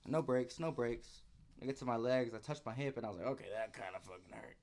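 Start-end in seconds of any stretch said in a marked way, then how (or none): 3.3-4.6 low, soft pained mutter; pained murmur. Retold or imitated. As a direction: say it under your breath, hurting. Pained remark after touching hip.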